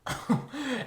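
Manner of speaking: high laughing burst; burst of laughter. Real, not acted. Laughs at the joke.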